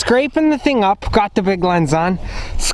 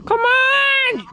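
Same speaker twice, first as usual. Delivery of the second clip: mock impatient yell. Yelling at rifle.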